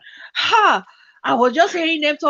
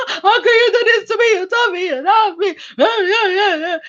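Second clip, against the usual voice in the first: mock crying; 7 semitones higher than usual. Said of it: "she was crying".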